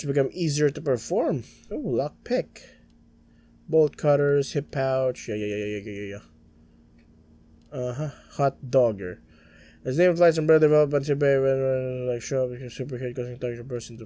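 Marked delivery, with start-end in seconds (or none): none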